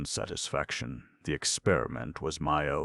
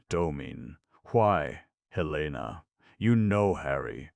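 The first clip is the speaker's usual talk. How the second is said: usual speaking voice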